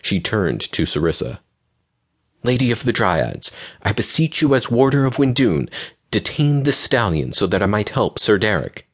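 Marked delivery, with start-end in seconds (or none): 2.4-8.9 higher-pitched formal plea; beseeching. A narrator's reading. Aurora asking the Lady of the Dryads for help, beseeching. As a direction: appeal earnestly, pitched up.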